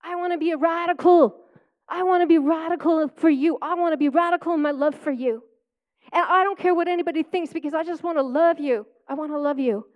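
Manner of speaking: a heartfelt prayer to the Lord; earnest prayer. Retold or imitated. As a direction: pray it out with longing. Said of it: "I actually pray".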